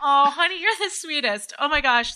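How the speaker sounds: high, teary, tender thanks; fighting back real tears; touched wife crying at her husband's kind words; tearful and touched. Real, not acted. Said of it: "I'm crying".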